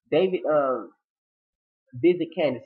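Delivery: usual speaking voice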